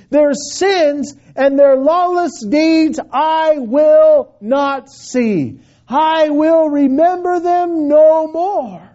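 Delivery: emphatic preaching. Acted. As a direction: drive each promise home to the congregation. Preaches with rising force.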